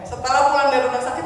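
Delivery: usual speaking voice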